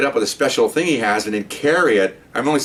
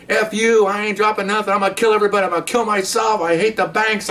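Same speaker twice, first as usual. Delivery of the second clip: imitating yelling bomber; 10 semitones higher than usual. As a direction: ham up the crazed bank robber raving. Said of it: "And the guy's yelling".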